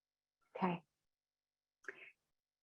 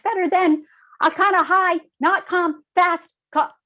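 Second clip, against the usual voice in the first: much higher, louder, rushed anxious voice; 10 semitones higher, 7 dB louder than usual. Shown as a demonstration. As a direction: show how a stressed, high voice sounds. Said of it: "that's not soothing".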